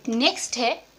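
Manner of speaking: ordinary talk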